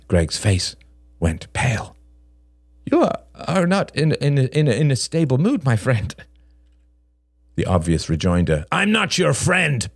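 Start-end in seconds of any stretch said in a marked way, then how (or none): 2.9-6.1 high, stammering nervous man; alarmed Greg. A narrator's reading. Narrator voices alarmed Greg. 8.7-9.9 high, drawn-out angry retort; angry rejoinder. A narrator's reading. Unspoken angry rejoinder in story.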